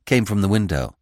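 ordinary delivery

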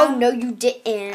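slow, sassy mock indignation; hammed-up sass. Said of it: "mock sass".

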